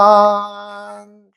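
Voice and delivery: high, long held final syllable; drawn-out reading. Shown as a demonstration. Teacher rereading the poem for class, drawn out. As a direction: hold the word out very slowly, high.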